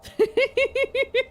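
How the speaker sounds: high squeaky cartoon laugh. Real, not acted. Laugh after character leaves.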